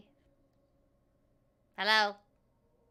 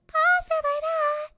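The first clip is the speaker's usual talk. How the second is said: 1 high voice; 10 semitones higher than usual. Pretend. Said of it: "repeats phrase in voices".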